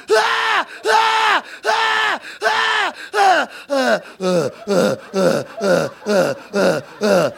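high screams fading down to plain; kids screaming, fading. Retold or imitated. Kids running out of energy, screams fading. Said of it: "kids are just like".

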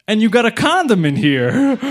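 high-pitched disbelief as the chief; mock-astonished cop, voice jumping up; incredulous police chief. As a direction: act out a baffled police chief. Police chief exclaims at wallet find.

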